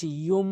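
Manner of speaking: ইয়ুম with the final m held as a long hum; nasal ghunnah. Shown as a demonstration. Teacher showing ghunnah, long nasal m ending ইয়ুম. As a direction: hold the ম of ইয়ুম through the nose. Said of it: "গুন্না করবেন".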